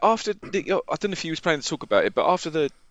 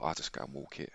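quiet resigned thought; 9 semitones lower, 16 dB quieter than usual. Retold or imitated. Inner thought, deciding to walk.

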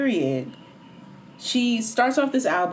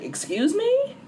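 much higher, incredulous excuse me; mock outrage. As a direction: play it scandalized and disbelieving. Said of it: "I'm like".

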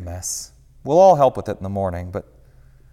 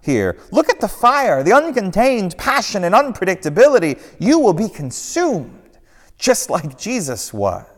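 high, loud, urgent alarm; urgent warning; 10 semitones higher, 5 dB louder than usual. A narrator's reading. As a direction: scare him about the fire. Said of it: "urgently in the ear".

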